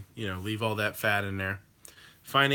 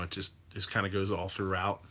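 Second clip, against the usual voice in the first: usual speaking voice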